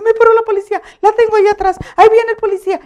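high, loud alarmed phone call; retold panic. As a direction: act out raising the alarm to someone on the line. (pánico imitado, voz aguda)

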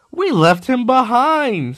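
high, slow, lightly sobbing; mock sob. The coaches confess to Thomas, a hammed-up sob. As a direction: draw it out in a hammed-up cry. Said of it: "sobbed Annie and Clarabelle".

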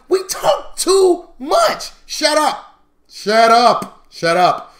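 high, drawn-out loud telling-off; exasperated scolding. Acted. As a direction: holler at them to stop talking. Scolding over-talkers in lesson.

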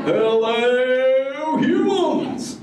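high, stretched surfer-turtle hello; surfer dude greeting. Acted. Turtle host greets the human audience, stoked surfer call.

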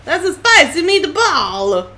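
loud slow Italian catchphrase; Italian chef voice. Retold or imitated. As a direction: ham up a spicy Italian cook. Catchphrase impression.

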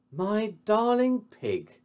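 high, slow, drawn-out endearment. Acted. Heroine soothes Pig by phone, high and cooing. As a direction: coo it in a lady's falsetto.

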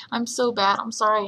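ordinary talk, nothing marked

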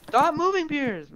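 high loud yell. Real, not acted. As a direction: shout it at your friend. Yelling at friend.

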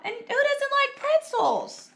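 very high, drawn-out question; eager exclamation. Acted. Hungry Buster eyeing Carla's sandwich, eager exclamation. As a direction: talk yourself into it with rising eagerness. Hungry boy persuading himself.

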